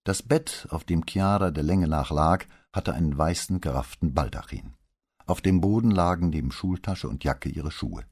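usual speaking voice

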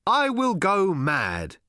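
slow, high, grand proclamation; formal announcement. A narrator's reading. Arthur announces his decision to the world, formally and slowly. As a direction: declare it solemnly, drawing it out. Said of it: "decided formally to announce his decision".

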